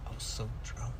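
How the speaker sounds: put-on low, very soft drunk-brother voice; quiet put-on hungover confession; imitating drunk brother. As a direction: mumble it like a sheepish drunk. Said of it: "he was like".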